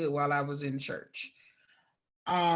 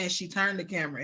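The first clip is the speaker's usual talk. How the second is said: normal talking voice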